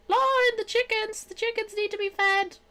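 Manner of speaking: very high, sing-song mock plea; hammed-up falsetto plea about chickens. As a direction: squeak it out like a dressed-up farmhand. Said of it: "and you'd be like".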